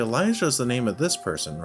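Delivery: ordinary delivery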